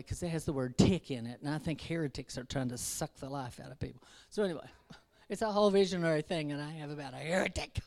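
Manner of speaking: normal talking voice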